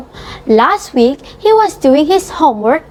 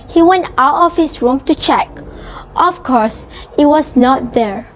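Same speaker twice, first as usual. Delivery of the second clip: ordinary delivery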